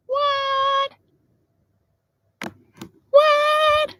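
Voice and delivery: high squeaky amazed what; surprised. Real, not acted. To viewers at the sunset, a high astonished what. Sunset view out the van door.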